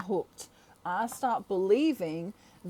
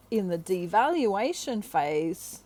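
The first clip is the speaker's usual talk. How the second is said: ordinary talk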